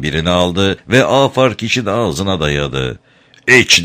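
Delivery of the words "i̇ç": loud roared command. A narrator's reading. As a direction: bellow it in a fury. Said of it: "diye kükredi".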